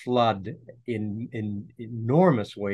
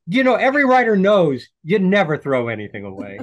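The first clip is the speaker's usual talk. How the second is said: louder, higher, driving the point home; emphatic; 8 semitones higher, 8 dB louder than usual. Real, not acted. Author insisting to interviewer, louder and higher. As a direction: proclaim it like a known rule.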